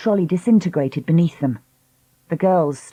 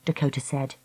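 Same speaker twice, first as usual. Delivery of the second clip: usual speaking voice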